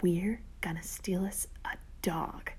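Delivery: slow, hushed whisper. A narrator's reading. Sister revealing her secret plan to brother, whispered. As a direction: whisper it slowly, letting each word land.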